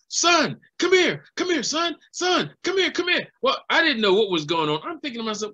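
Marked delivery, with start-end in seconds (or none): 0.1-3.2 urgent calling out. Retold or imitated. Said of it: "he started banging on the side of the tram".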